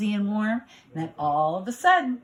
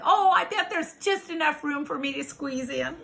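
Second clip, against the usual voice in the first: higher, hopeful bug voice; little bug; 7 semitones higher than usual. Acted. Little bug hoping to squeeze in, higher and hopeful. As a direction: coax as a tiny bug, pitched up.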